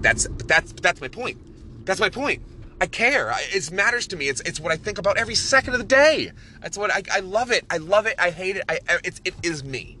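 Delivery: real, high-pitched passionate outburst; impassioned. Real, not acted. Podcaster insisting to listeners how much he cares, impassioned. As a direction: pour it out, worked up about what you care about. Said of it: "you could probably hear it in the voice".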